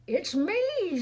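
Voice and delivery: high, sung-out fairy greeting; singsong fairy. A narrator's reading. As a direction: lilt it up like a cheeky fairy. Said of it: "sang the mess fairy".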